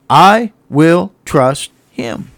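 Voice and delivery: very slow, word by word; solemn vow. Acted. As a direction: pause after each word with resolve.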